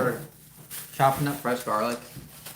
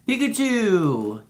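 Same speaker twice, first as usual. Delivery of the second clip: high, drawn-out delighted call; excited shout-out; 9 semitones higher, 5 dB louder, much slower than usual. Real, not acted. Streamer spotting a fun chat name, excited. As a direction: stretch the name out slowly with glee.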